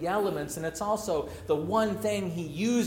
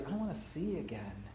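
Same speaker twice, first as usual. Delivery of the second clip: soft longing; 8 dB quieter than usual. Shown as a demonstration. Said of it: "The other is".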